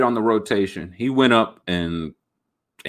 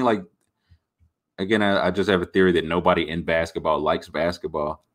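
ordinary talk

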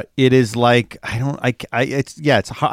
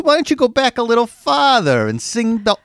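high, hammed-up old-timey New York voice; cartoon New York huckster, coaxing; Rocket, New York; 11 semitones higher than usual. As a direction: pitch it like a New York wise guy. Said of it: "Universal Hollywood New York's".

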